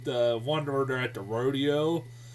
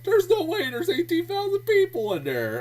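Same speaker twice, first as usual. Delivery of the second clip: high-pitched, incredulous squeal; cracking-up disbelief; 3 dB louder than usual. Retold or imitated. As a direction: retell amused disbelief way up high. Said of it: "I was kinda cracking up".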